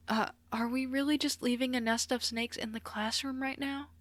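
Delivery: high-pitched anxious question; worried classmate's high plea; frantic. A narrator's reading. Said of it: "Uraka asked frantically".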